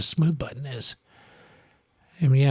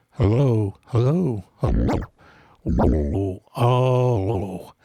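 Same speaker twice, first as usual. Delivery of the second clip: wonky tape effect; 3 semitones lower, much slower than usual. Real, not acted. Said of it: "Makes it sound like the tape is going wonky".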